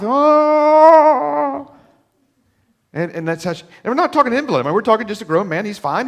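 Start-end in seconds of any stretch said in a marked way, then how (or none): 0.0-1.7 long high mock gasp, much louder; exaggerated gasp. Preacher reacting to wife feeding Jimmy, loud mock gasp.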